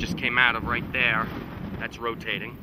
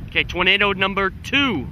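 loud, high thrilled call; excited shout; 8 semitones higher, 8 dB louder than usual. Real, not acted. Chaser spots another tornado.

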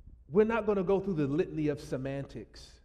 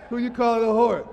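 mock offended; 6 semitones higher, 11 dB louder than usual.

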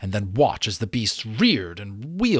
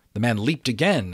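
ordinary delivery

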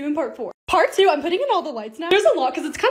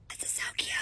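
hushed squeal; 14 dB quieter than usual. Real, not acted. Hushed squeal over car.

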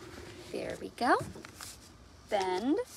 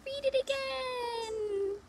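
higher, slow little plea; lightly voiced pleading child; 11 semitones higher, much slower than usual. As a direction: ask like a child begging for more. Child character asks again.

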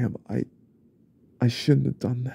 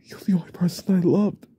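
ordinary delivery

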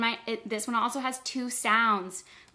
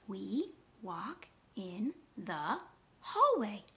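slow, softer counting pace; word by word; 7 dB quieter, slower than usual. Shown as a demonstration. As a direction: tap out each word slowly. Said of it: "Let's count it".

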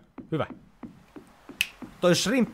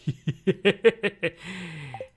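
loud, higher drawn-out laugh; long burst of laughter; 7 semitones higher, 4 dB louder than usual. Real, not acted. Gamer cracking up at the screen, loud higher laugh. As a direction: let a big laugh roll out and fade.

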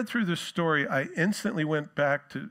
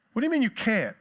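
incredulous retort; 7 semitones higher, faster than usual. Retold or imitated. Jacob's mock retort.

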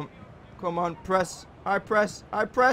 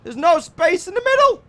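shout; 13 semitones higher, 8 dB louder than usual. Real, not acted. Yelling at football game players.

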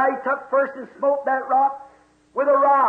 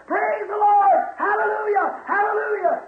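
joyful shouting; 8 semitones higher than usual. Acted. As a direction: shout praise for joy. Old man shouting upstairs.

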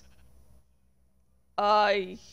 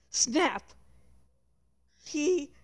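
plain reading voice

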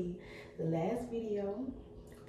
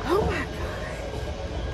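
slow breathless whispered oh my God; awed whisper; 11 semitones higher, much slower than usual. Real, not acted. Vlogger stares up the escalator in a slow awed whisper. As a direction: let the awe steal your voice.

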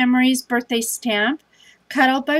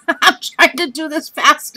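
laughing it off, a bit louder and higher; 4 semitones higher, 4 dB louder than usual. Real, not acted. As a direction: laugh through it, worn out. Tired vlogger laughs at herself.

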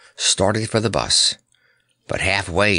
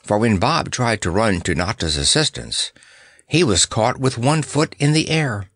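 ordinary delivery, nothing marked

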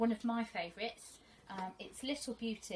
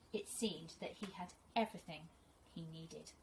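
teacher reading to her class, gentle and expressive; storybook reading; 5 dB louder than usual. Acted. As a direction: read it like a teacher sharing a picture book with her class. Reads picture book to pupils.

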